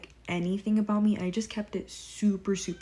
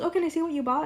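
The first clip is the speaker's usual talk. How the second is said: higher, eager mock coworker voice; imitating coworker; 9 semitones higher, 4 dB louder, faster than usual. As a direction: lightly mimic her eager question. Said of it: "She goes".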